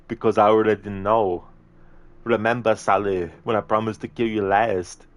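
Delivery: action-hero movie impression, forceful; Arnie. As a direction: play the menacing action hero interrogating his captive. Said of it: "an Arnie movie scene impression".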